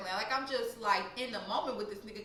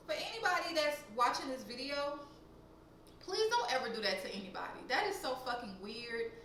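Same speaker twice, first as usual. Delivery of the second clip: ordinary delivery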